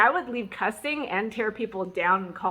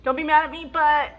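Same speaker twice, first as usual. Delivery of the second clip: high, louder wheedling plea; pleading; 6 semitones higher, 6 dB louder than usual. Retold or imitated. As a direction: coax him so he won't get mad. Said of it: "I'll go".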